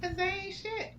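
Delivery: high, soft dismissive quip; throwaway shade. Real, not acted. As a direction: brush them off.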